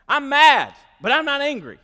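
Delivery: loud, high-pitched mock protest. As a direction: shout the denial in comic indignation. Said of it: "you get louder and louder".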